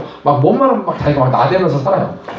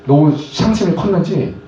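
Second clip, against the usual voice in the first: ordinary delivery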